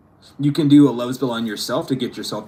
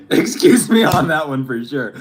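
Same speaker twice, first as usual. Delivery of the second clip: laughing apology; 2 semitones higher, 6 dB louder than usual. Real, not acted. Laughs at her own slip.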